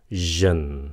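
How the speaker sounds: "jin" with a voiced buzzing zh; "jin" sound. Shown as a demonstration. Teacher has students listen closely, "jin" voiced zh. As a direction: model "jin" with a buzzed consonant. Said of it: "tương tự nó có âm z".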